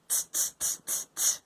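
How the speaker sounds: soft, breathy tutting; monkey tsk-tsk. A narrator's reading. Monkeys tutting back at the peddler, teasing. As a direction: tut back at him like a cheeky monkey. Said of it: "they said".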